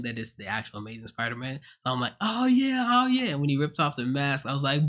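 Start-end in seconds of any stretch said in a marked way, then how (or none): 2.2-3.3 high, loud hype chant; hyped cheer. Retold or imitated. Fan in the cinema hyping up, high loud cheer. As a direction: cheer like your hero just showed up.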